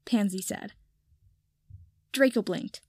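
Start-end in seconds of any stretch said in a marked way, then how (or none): none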